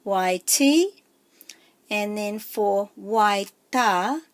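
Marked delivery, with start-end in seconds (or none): 0.0-1.0 "Y T" with a long, strong T; stress on T. Shown as a demonstration. Teacher demonstrating a macron, stress on the final T of "Y T". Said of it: "that's going to be more pronounced". 2.9-4.2 "Y ta" with the ta held long; stress on ta. Shown as a demonstration. Teacher showing another macron word, stress on ta in "Y ta". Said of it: "putting more emphasis on that syllable".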